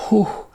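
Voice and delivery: high, loud "whew"; loud sigh of exhaustion; exasperated whew. Real, not acted. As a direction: blow out a big whew over an ordeal. Exhales over the long lines.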